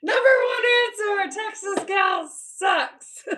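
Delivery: high, loud, stretched-out announcement; hammed-up game-show yell; Family Feud game-show shout. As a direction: holler it like the top survey answer. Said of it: "Family Feud said".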